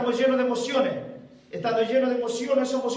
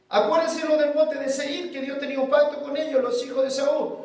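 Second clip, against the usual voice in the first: full-force preaching; 4 semitones higher than usual. Acted. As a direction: preach it hard to the church.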